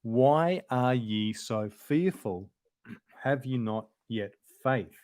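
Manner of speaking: ordinary delivery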